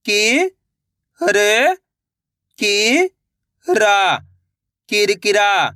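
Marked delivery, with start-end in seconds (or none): 0.1-4.2 "कि-र-कि-रा" very slowly, syllables apart; sounding out slowly. Shown as a demonstration. Teacher showing children how to sound out a word. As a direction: sound out "कि-र-कि-रा" piece by piece. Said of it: "एक एक अक्षर का उच्चारण करके".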